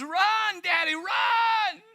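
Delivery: high, loud frightened cry to his dad; panicked shout. Acted. Boy at the zoo yells to his dad, panicked and loud. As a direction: scream it like a terrified little boy. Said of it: "with this panicked voice".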